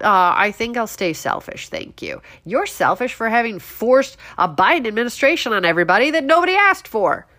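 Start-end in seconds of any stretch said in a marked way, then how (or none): none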